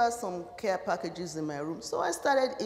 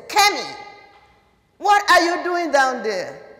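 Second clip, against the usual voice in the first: loud, higher call across the room; stern professor calling from the front; 7 semitones higher, 12 dB louder than usual. Retold or imitated. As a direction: call her name sharply across the hall. Professor calling out in exam hall.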